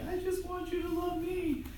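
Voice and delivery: high-pitched plea from the wife; wife's pleading. Retold or imitated. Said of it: "she's like".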